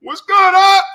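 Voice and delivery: high, loud excited greeting. Real, not acted.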